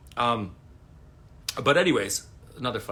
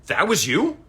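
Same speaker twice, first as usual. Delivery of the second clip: high, louder, astonished rising question; suddenly realizing, amazed; surprised question; 9 semitones higher, 8 dB louder than usual. Retold or imitated. Friend realizes who it was.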